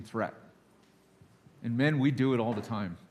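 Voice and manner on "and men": ordinary talk, nothing marked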